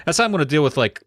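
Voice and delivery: ordinary talk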